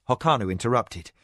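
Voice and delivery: plain reading voice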